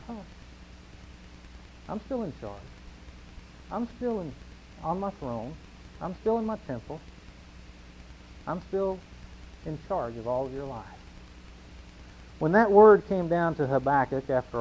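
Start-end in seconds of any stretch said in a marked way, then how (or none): 0.1-10.9 very soft, tender comforting voice; soothing reassurance. Retold or imitated. God comforting his struggling people, soft and tender. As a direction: hush a child gently to sleep. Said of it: "he's rocking us... he's saying".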